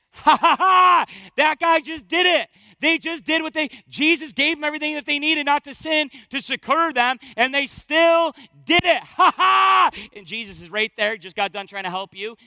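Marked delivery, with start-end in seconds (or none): 0.1-9.9 high, loud gloating shout with mock laughter; mocking accuser. Accuser gloating before the Father, jeering laugh. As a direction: cackle and jeer like the accuser. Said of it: "the accuser of the brethren's coming to say".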